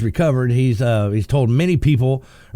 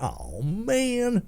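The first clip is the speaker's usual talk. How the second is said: dismayed; 9 semitones higher, 6 dB quieter than usual. Acted. Driver spotting police behind.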